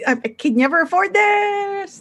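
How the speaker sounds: high-pitched, loud character voice; apologetic pleading in a voice pitched well up; Flips. Acted. Flips calls out mid-filming, high and flustered. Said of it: "Flips just like".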